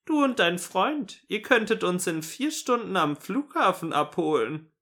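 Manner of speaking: very high, sing-out granny voice; cheerful grandma. A narrator's reading. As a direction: play a merrily imposing grandmother. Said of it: "sagte sie fröhlich".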